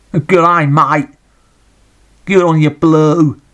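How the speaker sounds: a jokey Australian shout of praise for a throw; mock Australian accent. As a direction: do a cheery Aussie cheer, drawn out. Bloke with a boomerang.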